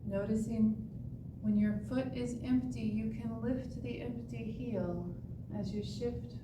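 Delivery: ordinary talk, nothing marked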